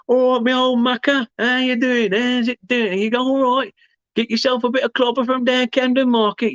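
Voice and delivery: high hammed-up cockney voice; London accent. Streamer teasing a chat viewer in mock cockney. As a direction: ham up a cheeky London geezer, pitched well up. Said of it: "I do love accents and stuff".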